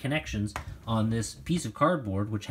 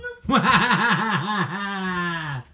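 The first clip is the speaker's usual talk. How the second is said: laughing; 8 semitones higher, 7 dB louder than usual. Real, not acted. Laughs at the alarm sound.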